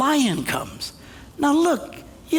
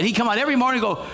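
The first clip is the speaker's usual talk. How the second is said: ordinary talk, nothing marked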